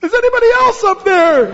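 high, loud comic yell. Acted. Climber yells up after a moment of silence, yelling. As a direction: shout past God for someone else. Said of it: "yelled up".

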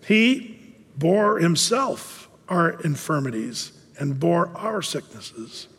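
slow, solemn quoting of scripture; slow scripture reading. Shown as a demonstration. Preacher slowly rereads the verse to the congregation. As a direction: lay each word down slowly. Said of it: "That's what the scripture is saying".

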